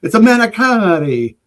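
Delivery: high, loud fake Italian; hammed-up pretend Italian speaker; mock Italian. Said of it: "thinks he speaks Italian".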